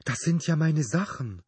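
high-pitched cry of surprise; surprised exclamation. A narrator's reading. Phoebe finding her own things, surprised exclamation. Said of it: "ein überraschender Ausruf".

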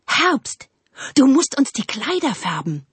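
high, insistent call to Autumn; clamouring. A narrator's reading. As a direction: demand it as the clamouring trees. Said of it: "Dann brach ein Sturm los". (stürmisch fordernd)

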